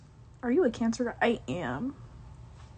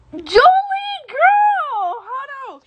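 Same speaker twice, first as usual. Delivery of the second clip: high excited shriek of a name; excited squeal; 12 semitones higher, 5 dB louder, much slower than usual. Real, not acted. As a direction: squeal it out, thrilled. Excited calling out to viewer.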